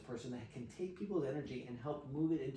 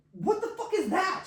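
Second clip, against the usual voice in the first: high, loud, laughing shock; incredulous outburst; 8 dB louder than usual. Retold or imitated. Man hearing the explanation of the medicine, incredulous outburst. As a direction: retort in hammed-up disbelief, pitched way up. Acting his shocked reaction.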